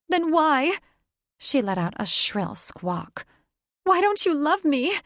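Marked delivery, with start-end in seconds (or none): 0.1-0.8 much higher, louder wail; shrill anguish. Acted. Catherine demands answers from Thaddeus, shrieking. 3.8-4.9 much higher, louder heartbroken cry; anguished shriek. Acted. Catherine begs Thaddeus for love, high and wailing.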